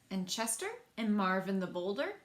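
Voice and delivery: plain reading voice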